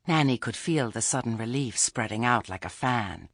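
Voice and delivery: plain reading voice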